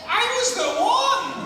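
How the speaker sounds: high, loud indignant cry; imitating indignant lady. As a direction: blurt it out, offended. Script supervisor interrupting indignantly.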